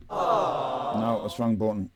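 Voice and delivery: plain reading voice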